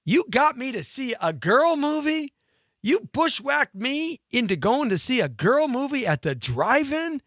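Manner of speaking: high-pitched mock outrage; hammed-up betrayed boyfriend; indignant disbelief. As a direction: accuse them on a rising, incredulous voice. Acted outrage at being tricked.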